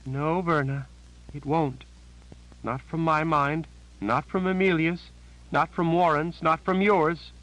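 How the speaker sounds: high, shaken voice of a terrified sister; a scared woman blurting out bad news; frightened Janet. A narrator's reading. Janet voiced, rising dread.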